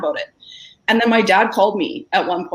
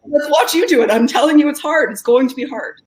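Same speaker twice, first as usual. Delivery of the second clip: defiant; 7 semitones higher, 3 dB louder than usual. Real, not acted. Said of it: "defiant retort".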